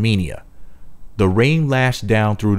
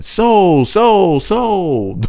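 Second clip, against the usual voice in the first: much higher, louder echo calling out; booming echo; 10 semitones higher, 5 dB louder, much slower than usual. Shown as a demonstration. Narrator redoing the echo louder each time, booming. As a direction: boom the word back like a cave throwing it. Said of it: "each repetition louder than the first".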